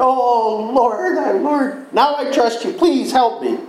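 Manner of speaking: high, pleading mock cry for help; desperate drowning plea, acted. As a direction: beg to be saved while sinking. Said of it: "he cries out to the Lord".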